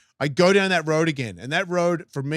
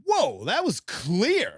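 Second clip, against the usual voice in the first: drawn-out startled whoa; slowly taken aback; slow surprised; 6 semitones higher, much slower than usual. Retold or imitated. Startled reaction to voice.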